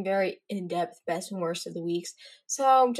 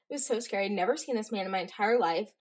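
ordinary delivery

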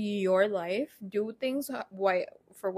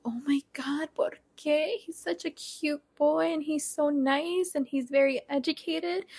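higher, protesting mom; imitación de mamá, más aguda; imitating mom; 7 semitones higher than usual. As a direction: play her pleading mother, with "¿por qué?" in Spanish. Mom's reaction voiced in story.

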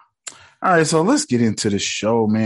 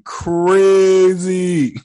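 slow, high, drawn-out wonder; real amazement; amazed; 7 semitones higher, 4 dB louder than usual. Real, not acted. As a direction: stretch it out in disbelief. Amazed aside.

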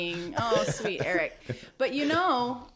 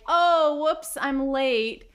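mocking latecomer; 7 semitones higher, 6 dB louder, much slower than usual.

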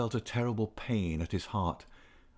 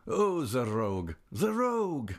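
lightly acted amusement, leaping high on the repeat; amused exclamation; 5 semitones higher than usual. A narrator's reading. Count outside amused at the young man, mildly exclaiming. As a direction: exclaim it with a touch of laughter in mind.

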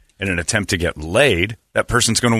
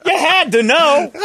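high, loud, incredulous laughter in the words; laughing outcry; 4 dB louder than usual. Real, not acted. Host finding colleague not in the room, laughing high-pitched outburst. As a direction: cry out through real laughter.

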